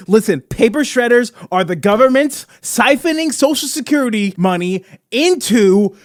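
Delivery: hammed-up, emphatic conspiracy ranter; conspiracy theorist rant. Conspiracy host exposing paper shredders to listeners, hammed-up outrage. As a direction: rant with mock outrage, punching key words.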